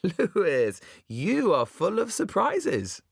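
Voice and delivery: high, slow and grandly theatrical; mock theatrical. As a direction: lay on the stage pomp. Lloyd mocks in Shakespearean speech.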